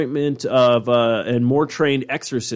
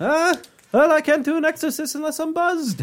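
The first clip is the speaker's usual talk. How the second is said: high-pitched comic Irish priest voice; hammed-up Irish accent. Joking as an Irish priest wanting exorcists, hammed-up Irish accent. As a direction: squeal it in a hammed-up Irish brogue. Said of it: "As he's chugging a beer".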